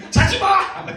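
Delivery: very loud, high demo shout; emotional yell. Shown as a demonstration. Pastor showing children how feeling enters a shout, scolding yell. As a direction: burst out as if scolding someone dozing. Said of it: "이렇게 감정이 들어가요".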